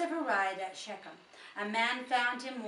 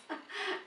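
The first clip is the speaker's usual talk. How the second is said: short laugh; 10 semitones higher, 5 dB quieter than usual. Real, not acted. Laughs at own misreading.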